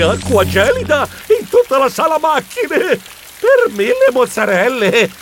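high, startled cry over the din; high-pitched shocked exclamation; alarmed outcry. A narrator's reading. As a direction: call out in panic as icy water falls. Narrator startled by icy downpour.

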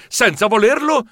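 ordinary delivery

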